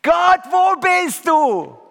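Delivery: high, loud, crying out to God in despair; a psalmist wailing at a silent God; a desperate shout into the dark, pleading and accusing; anguished lament. Acted. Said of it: "Lobpreis im Sinne vom Alten Testament geht so".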